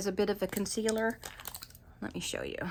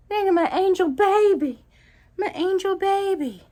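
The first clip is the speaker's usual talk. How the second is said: talking to a cat; 7 semitones higher, 4 dB louder than usual. Real, not acted. Cuddling and praising her cat.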